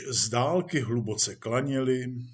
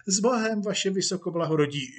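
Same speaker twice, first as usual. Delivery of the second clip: higher, brisk, obliging farewell; deferential actor; 6 semitones higher, faster than usual. Acted. Actor says goodbye to police chief, deferentially. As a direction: take polite leave of a superior.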